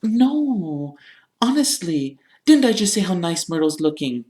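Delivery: high, insistent reassurance; Hermione. A narrator's reading. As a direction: protest innocently in a high voice. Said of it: "said Hermione".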